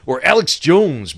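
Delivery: usual speaking voice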